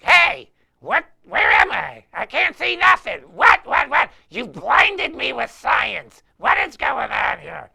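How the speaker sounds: high, loud, frantic bandmate voices; mock panic, shouting where am I; imitating panicked bandmates. Bandmates lost in the dark on stage, loud frantic mock panic. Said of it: "[name] and [name] are like".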